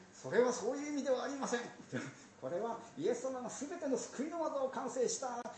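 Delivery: high, earnest lecturing pastor; preachy pastor. Retold or imitated. Zealous pastor lecturing the tired beggar, high and earnest. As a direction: launch into a sermon at the beggar. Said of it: "また説教が始まっちゃった".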